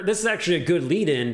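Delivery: ordinary delivery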